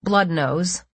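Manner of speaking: ordinary delivery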